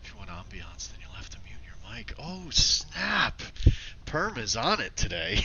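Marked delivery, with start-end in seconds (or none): 2.2-5.4 high, loud delighted reaction; real excited burst; amused excitement. Real, not acted. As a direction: cry out, impressed by the joke. Reacting to a viewer's joke.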